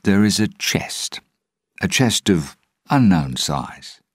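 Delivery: measured, weighty storytelling with pauses; slow. A narrator's reading. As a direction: let it out slowly, piece by piece. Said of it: "Jack began slowly".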